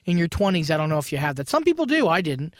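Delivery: usual speaking voice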